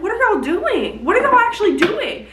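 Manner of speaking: high, rising outrage at the characters; exasperated disbelief. Retold or imitated. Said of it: "I was like".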